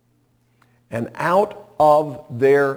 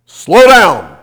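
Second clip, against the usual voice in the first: loud, higher sharp shout of warning; 7 semitones higher, 16 dB louder than usual. Retold or imitated. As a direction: call out a sudden urgent warning. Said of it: "a voice that said".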